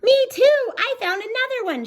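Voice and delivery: very high, loud little bunny shout; excited bunny shout. Acted. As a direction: shout it with glee. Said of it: "shouted another little bunny".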